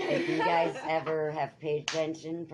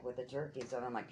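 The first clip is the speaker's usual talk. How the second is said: usual speaking voice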